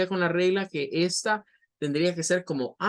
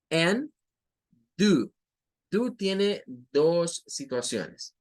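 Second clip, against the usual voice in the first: ordinary delivery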